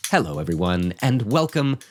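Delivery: upbeat podcast host sponsor read; cheerful, practiced host pitching Patreon; mid-roll ad read. Acted. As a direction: read the promo like a friendly radio spot.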